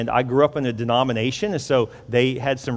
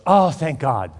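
louder burst of relief; acted relief; 2 semitones higher, 6 dB louder, slower than usual. Wife on the phone hearing they won't move, hugely relieved. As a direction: imitate a wife letting out her relief.

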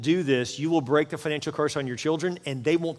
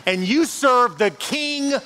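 high, loud, slow preaching swell; preaching at full force; 7 semitones higher, 3 dB louder, slower than usual. Acted. Pastor proclaiming the King of Kings to the church, in a slow, soaring preach. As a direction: stretch each phrase out and build. Preaching climax on God's riches.

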